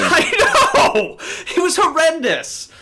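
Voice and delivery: high, loud laughing wail; laughing exclamation. Real, not acted. Storyteller agrees with disgusted co-host, wailing with laughter.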